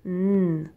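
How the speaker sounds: "N" as a long, loud nnn hum; isolated sound. Shown as a demonstration. Teacher marking the first sound for pupils, sustained "N". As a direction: hold the consonant "N" without a vowel. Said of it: "On entend N".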